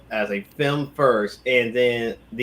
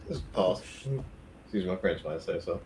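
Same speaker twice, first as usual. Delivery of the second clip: chewing while talking; 3 semitones lower, 10 dB quieter than usual. Real, not acted. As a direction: mouth full of boba. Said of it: "excuse my, me eating on some, uh, boba here".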